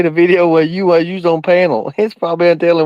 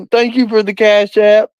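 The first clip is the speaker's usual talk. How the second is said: ordinary delivery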